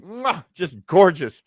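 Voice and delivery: normal talking voice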